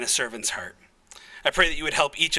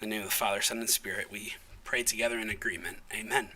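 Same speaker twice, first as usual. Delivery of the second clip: lower, softer prayer close; soft reverent close; 8 semitones lower, 7 dB quieter than usual. Acted. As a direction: settle into a hushed reverent ending.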